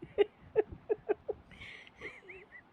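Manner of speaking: high, loud burst of laughing; a long high giggling fit; loud laughter. Acted. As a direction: laugh hard at a scared friend. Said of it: "Megha इतनी ज़ोर से हंसती है".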